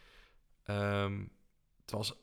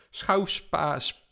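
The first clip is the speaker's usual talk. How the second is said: blurting it out higher and louder after stumbling; giving up on schouwspektakel, louder and higher; tongue-tied; 6 semitones higher, 6 dB louder, much slower than usual. Real, not acted. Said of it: "dit komt wel heel erg slecht mijn mond uit". (gefrustreerd struikelend, hoger en luider)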